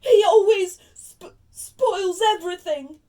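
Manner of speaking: hammed-up wail, high, loud and slow; yelling, pretend sobs. Spoilt son protesting to his mother, fake-sobbing yell. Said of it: "yelled between huge pretend sobs".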